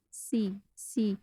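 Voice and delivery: सी very short and soft, one count; one count only. Shown as a demonstration. Teacher shows the short one-count कसरा on सी. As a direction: clip सी to a single beat, quietly. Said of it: "एक हरकत तक लंबा पढ़ेंगे".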